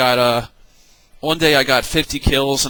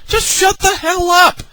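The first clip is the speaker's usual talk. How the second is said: high, fed-up outburst; exasperated snap; 13 semitones higher than usual. Real, not acted. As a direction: cut them off in exasperation. Fed up with complainers.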